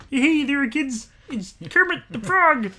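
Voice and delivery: very high, loud puppet voice; Kermit the Frog. Acted. Podcaster springing his puppet impression on a friend to scare him, Kermit voice. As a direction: shout it out as an excited puppet host. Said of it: "I want to do the kind of Kermit voice".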